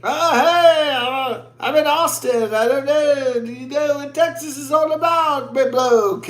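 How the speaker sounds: high-pitched put-on Aussie come-on; sing-song caricature of a bloke working the bar; mock bar predator. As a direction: imitate a predator's chat-up in a high voice. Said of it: "They'll, they'll be like".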